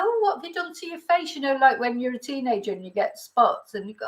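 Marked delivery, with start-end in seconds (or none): 0.0-1.4 high-pitched mock dad's remark; brutally honest dad. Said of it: "my dad was so brutally honest".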